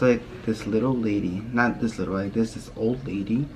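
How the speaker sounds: ordinary delivery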